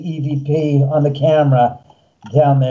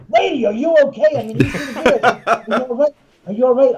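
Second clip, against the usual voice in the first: higher, laughing through the questions; laughing concern; 7 semitones higher, faster than usual. Retold or imitated. Host retelling how he ran to the woman, laughing and higher. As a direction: ask if she's okay while cracking up.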